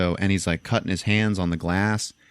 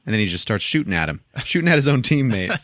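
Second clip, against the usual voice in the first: usual speaking voice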